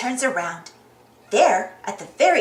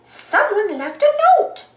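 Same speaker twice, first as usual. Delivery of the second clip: high, slow announcing cry; high-pitched cartoon discovery; excited cry; 8 semitones higher, 4 dB louder, slower than usual. A narrator's reading. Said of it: "cries Cuckoo Loca".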